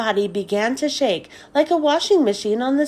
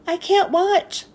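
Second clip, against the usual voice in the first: exclaimed; 8 semitones higher than usual. Acted. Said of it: "she exclaimed".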